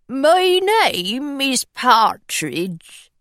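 slow, thin, anxious pirate voice; a timid pirate introducing himself. Acted. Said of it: "His voice was thin and anxious".

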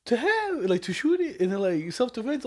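high, unsure justification; squeaky excuse. Retold or imitated. Explaining his Uzi plan to coworkers, high unsure voice. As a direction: fumble it out, voice up high.

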